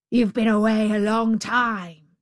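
loud savage-beast growl; growling tiger. A narrator's reading. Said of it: "growled the savage beast".

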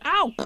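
high bumped yelp; little animal's ow; squeaked "ow". Acted. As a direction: yelp as you land. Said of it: "as he came down, he said".

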